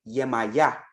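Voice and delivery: Yemaya said as yeh-ma-YA, rising at the end; the Caribbean form, Yemaya, spoken carefully; Caribbean pronunciation. Shown as a demonstration. Teaching viewers the Caribbean name, careful pronunciation. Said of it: "In the Caribbean".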